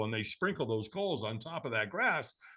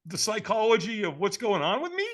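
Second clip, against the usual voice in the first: high-pitched mock protest; comically aggrieved, raised pitch; exasperated complaint; 7 semitones higher than usual. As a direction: whine it at the absent guru. Mock complaint to absent seminar leader.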